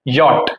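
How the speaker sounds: "यॉट" blended as one syllable, yot; German letter J. Shown as a demonstration. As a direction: model "यॉट" with y, short o, final t. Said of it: "German में इसको बोलते हैं यॉट". (German अक्षर J का "यॉट" उच्चारण)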